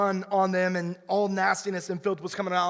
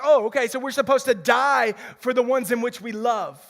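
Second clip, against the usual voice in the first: mock naive reading; 4 semitones higher, 6 dB louder than usual. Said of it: "we read this text and we're like".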